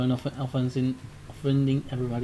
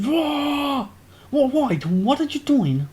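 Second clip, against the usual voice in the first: high, loud alarmed cry; a shopkeeper caught off guard yelling; startled shout; 10 semitones higher, 4 dB louder, slower than usual. Acted. The shopkeeper shouts at the robbers, startled and loud. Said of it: "the man shouts".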